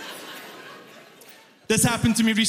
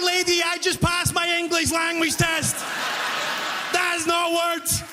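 exasperated protest; 8 semitones higher than usual. Retold or imitated. Immigrant cashier to customer, high exasperated protest. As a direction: protest in helpless disbelief.

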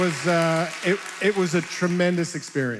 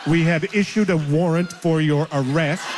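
robot voice; 3 semitones lower than usual. Retold or imitated. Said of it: "I get a robot voice saying".

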